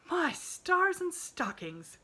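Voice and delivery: high, slow, shocked gasp. A narrator's reading. Nancy gasping at Pollyanna climbing down the tree. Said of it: "gasped Nancy".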